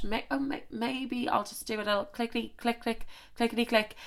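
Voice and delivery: hushed, dreamy little inner voice; quiet musing. Retold or imitated. Imagining Meghan musing quietly.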